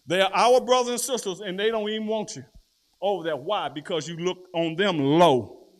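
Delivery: loud, high, fired-up sermon delivery; full-force preaching. Acted. Preacher to congregation, loud fiery preaching.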